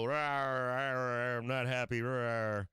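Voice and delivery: mock bear growl, grumbling about being unhappy; playful imitation of a grumpy growling bear. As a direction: growl it like a cartoon bear sulking. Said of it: "And they go".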